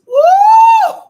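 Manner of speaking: high shouted woo; ecstatic whoop. Real, not acted. Preacher whooping to the congregation, loud high woo. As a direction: let out a joyful preacher's whoop.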